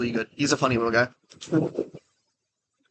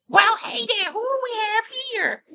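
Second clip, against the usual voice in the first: very high squeaky clown voice; helium clown voice. Acted. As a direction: talk like you inhaled helium. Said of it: "I was doing the voice".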